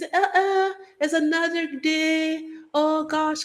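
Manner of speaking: a high, bouncy West Indian accent, self-admittedly bad. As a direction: lift every word up into a Caribbean lilt. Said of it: "It can be the West Indians".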